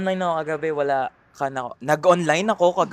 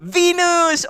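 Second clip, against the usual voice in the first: high, loud call-out; excited exclamation; 11 semitones higher, 6 dB louder, much slower than usual. Real, not acted. Streamer calling out to a viewer by name, high and excited. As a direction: pitch it way up and call out.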